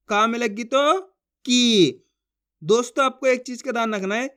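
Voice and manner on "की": की loud with a long held ee; drawn-out vowel. Shown as a demonstration. Teacher models का with बड़ी ई, की drawn out long. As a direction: stretch the ई in की. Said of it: "जिस तरीके से मैं खींच खींच के पढ़ रहा हूँ".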